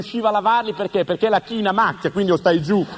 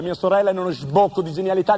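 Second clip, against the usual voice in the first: normal talking voice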